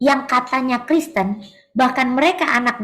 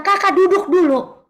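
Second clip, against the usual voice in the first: bossy order; 6 semitones higher, 3 dB louder than usual. Retold or imitated. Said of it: "Saya diperintah begitu sama dia".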